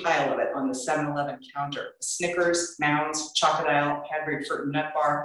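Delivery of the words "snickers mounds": ordinary delivery, nothing marked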